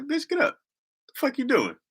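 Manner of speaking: high-pitched comic outrage; mock indignant scolding. Scolding the sleepy caller.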